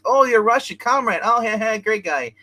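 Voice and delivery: mock-hearty greeting, high and loud; delighted greeting. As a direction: act out a delighted hello to a comrade. Acted delighted greeting to a comrade.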